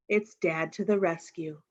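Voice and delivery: ordinary delivery, nothing marked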